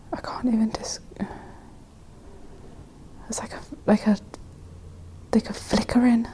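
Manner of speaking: hushed, frightened whisper. Real, not acted. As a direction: whisper it, barely breathing.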